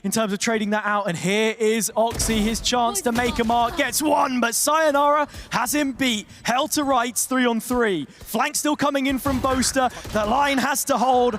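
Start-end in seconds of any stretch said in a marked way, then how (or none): 1.1-11.4 animated esports call, often higher in pitch; live caster energy; excited play-by-play. Real, not acted. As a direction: follow the fight beat by beat.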